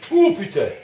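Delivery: sudden high loud cry of fright; startled curse. Real, not acted. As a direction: jump out of your skin and yell. Sudden scare in horror game.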